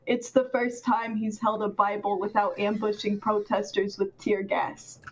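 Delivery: low, even monotone read. Shown as a demonstration. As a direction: read it dead flat, no rise or fall. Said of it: "supposed to read in a monotone voice".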